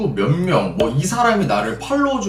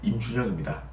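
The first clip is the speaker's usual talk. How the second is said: mock suave greeting; 6 semitones lower, 9 dB quieter than usual. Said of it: "이렇게 쪽지를 내가 보냈지".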